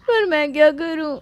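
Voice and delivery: high, helpless whine; crying. Acted. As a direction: ask a friend tearfully for help. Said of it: "सबसे पहले तो रोना बंद कर". (रोती हुई, बेबस आवाज़)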